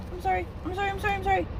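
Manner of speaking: high frantic pleading; panicked apologies. Real, not acted. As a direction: gabble sorry in real fright. Apologising to the spider.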